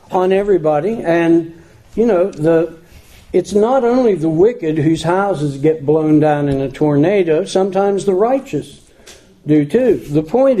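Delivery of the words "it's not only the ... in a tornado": normal talking voice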